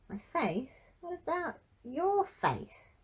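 high, drawn-out indignant comeback; defensive. A narrator's reading. Frankie turns the remark on granddad, defensively. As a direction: throw it back, pitch leaping up. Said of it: "Frankie said defensively".

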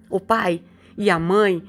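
ordinary talk

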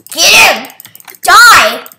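big excited game yell; battle shout. Real, not acted. Yelling during a game fight.

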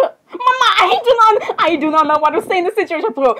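high-pitched, flustered protest; squeaky, overwhelmed protest; flustered outburst. Acted. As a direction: burst out high, then rush the words. Acted flustered protest to the others.

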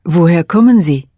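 ordinary talk

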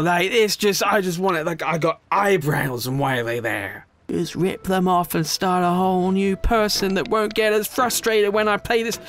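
ordinary talk

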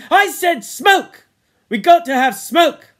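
high, loud, furious insistence; fierce shout. A narrator's reading. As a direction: round on them at full volume, drawing out the words. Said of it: "he turned on the twins fiercely".

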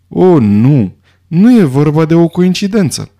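ordinary delivery

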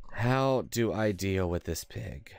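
drawn-out HOW, then word by word; slow, deliberate question. Real, not acted. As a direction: stretch the question out slowly from "How". Repeats the question slowly, deliberately.

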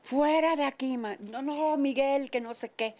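father chasing off the mariachis, angry; furious father. Retold or imitated. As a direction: throw the suitor out, a touch furious. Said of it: "mi papá furioso".

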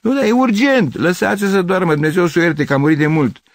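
high, loud exchange; agitated, insistent outburst; urgent shouting. Acted. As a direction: argue loudly across a street. Argument over calling dead woman.

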